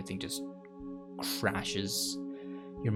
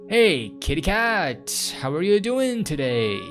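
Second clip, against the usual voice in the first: high, drawn-out greeting to a kitty; singsong pet-talk, pitched up; cooing to a cat; 7 semitones higher, 5 dB louder, slower than usual. Acted. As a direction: coo slowly at an adorable pet.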